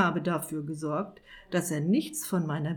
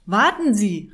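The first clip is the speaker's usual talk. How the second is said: loud, high desperate call; 3 semitones higher, 11 dB louder than usual. Acted. Wizard calling after the departing bailiff, loud. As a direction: shout after him to stop him. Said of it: "rief Herr Irrwitzer".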